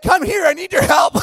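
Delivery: yelling for help. Retold or imitated. Speaker calling to Morgan and friends, panicked high yell. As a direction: call out in panic to the passing girls. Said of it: "start yelling at them".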